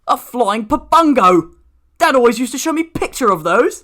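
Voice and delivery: amazed. A narrator's reading. Milton spots a creature from his dad's photos, amazed. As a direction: marvel at a creature you only saw in pictures.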